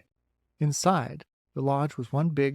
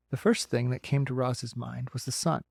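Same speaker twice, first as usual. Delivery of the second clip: usual speaking voice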